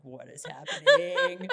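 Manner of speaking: slow, loud mock bewilderment; bewildered cameraman. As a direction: stretch it out as a stunned onlooker, half laughing. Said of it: "the poor cameraman is like".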